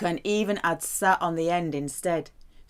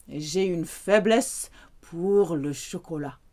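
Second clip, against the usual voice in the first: ordinary talk, nothing marked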